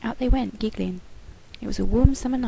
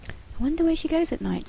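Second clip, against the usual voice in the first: put-on musing boy voice; 8 semitones higher than usual. A narrator's reading. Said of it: "James mused".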